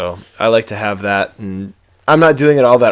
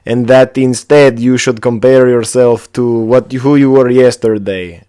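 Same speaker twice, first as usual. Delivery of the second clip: put-on amateur Russian accent, loud and deliberate; put-on Russian accent, raised voice; 3 semitones higher, 9 dB louder than usual. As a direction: declaim it in a rough Russian accent. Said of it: "I don't know how to do a Russian accent".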